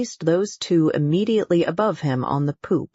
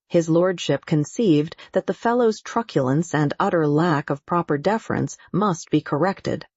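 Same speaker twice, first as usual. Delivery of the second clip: ordinary delivery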